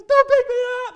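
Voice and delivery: put-on scared kid yelling at the man chasing him; panicked plea. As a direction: scream it while running away. Fleeing kid begs preacher.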